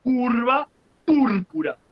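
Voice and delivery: slow, long U in "curva" and "púrpura"; teacher drawing out each U of "curva púrpura"; stress on U. Shown as a demonstration. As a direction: stretch CUR and PÚR in "curva púrpura". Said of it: "para el acento".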